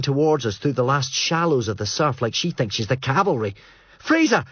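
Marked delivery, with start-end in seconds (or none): 4.0-4.4 much higher, desperate shout; yell. Acted. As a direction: scream his name from the surf. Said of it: "she yells".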